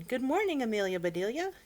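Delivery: hearty greeting. Acted. Mister Rogers greets.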